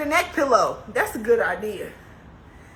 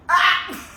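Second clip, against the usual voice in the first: pained laughing ah; 7 semitones higher, 6 dB louder than usual. Real, not acted. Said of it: "I can't even lay back".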